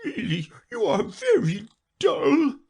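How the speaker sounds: high, crying turtle voice; a weepy turtle chiding a dull pupil; lightly voiced sobbing reproach; tearful scolding. Mock Turtle scolding Alice.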